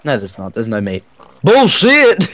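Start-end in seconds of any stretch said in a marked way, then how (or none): 1.4-2.3 loud indignant yell; raging gamer. Real, not acted. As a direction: shout it in disbelief. No meat found.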